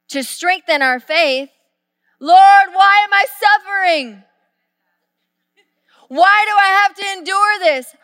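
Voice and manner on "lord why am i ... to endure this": loud, high pleading whine to God; mock the suffering believer's wail; a hammed-up cry of why me; whiny complaint.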